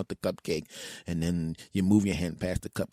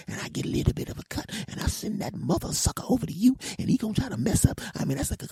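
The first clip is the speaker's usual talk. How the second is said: angry woman's rant; 11 semitones higher than usual. Retold or imitated. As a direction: rant way up high about the snitch. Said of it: "her voice went from this to this".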